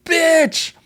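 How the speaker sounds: high, loud shriek at a friend; mock yelling. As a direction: imitate her screaming it in playful outrage. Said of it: "she's yelling at her and screaming".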